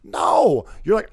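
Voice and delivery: usual speaking voice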